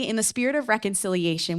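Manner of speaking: ordinary talk, nothing marked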